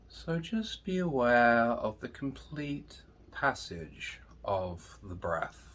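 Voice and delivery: soft, slow meditation voice with long pauses; guided meditation. Acted. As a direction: guide listeners gently inward, hushed and unhurried.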